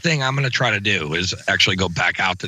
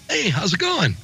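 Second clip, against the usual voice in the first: "Hey, how's it going?" said higher and lively; bright greeting; 9 semitones higher than usual. Shown as a demonstration. Showing friends his normal-life greeting, higher and friendly. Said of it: "You know, I'm not like".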